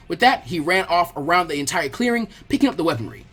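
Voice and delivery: usual speaking voice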